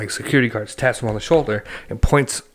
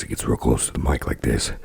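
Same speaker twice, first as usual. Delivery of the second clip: much lower, eerie close-mic voice; weird DJ voice; 12 semitones lower than usual. Retold or imitated. Imitating the DJ at the awards show, weird low voice. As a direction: drop way down, right up on the mic. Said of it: "in this weird voice".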